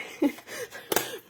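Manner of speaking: a high, light giggle at herself; giggle, amused at her own praise; a quick self-conscious giggle. Real, not acted.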